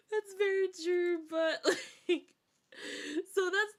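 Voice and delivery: high, loud words squeezed through laughter; real laughing fit. Real, not acted. Streamer agreeing with a remark, helpless with laughter. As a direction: agree while cracking up.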